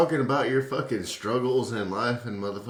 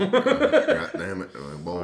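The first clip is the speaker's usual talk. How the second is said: thick Southern drawl; 8 semitones higher, slower than usual. Pretend. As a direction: stretch it out like a country boy. Joking about drunk Southern accents.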